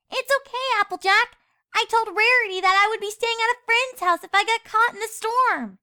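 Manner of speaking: high, sweet little filly reassuring; young filly Sweetie Belle. A narrator's reading.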